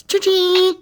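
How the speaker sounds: high-pitched cash register cha-ching; cha-ching sound effect. Acted. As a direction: squeal it like a till ringing.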